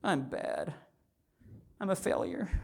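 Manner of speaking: a hint of a small child putting herself down; lightly voiced four-year-old calling herself bad; little girls' self-talk. Daughter at the piano, mildly mimicked small voice. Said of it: "You know what they say?".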